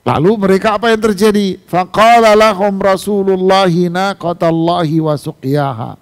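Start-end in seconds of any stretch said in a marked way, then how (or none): 1.7-5.9 Quran recitation. Acted.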